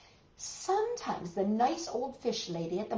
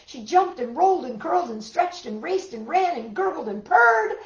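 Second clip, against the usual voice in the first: building excitement; 6 semitones higher, 9 dB louder than usual. A narrator's reading. Frenzied cat play read aloud.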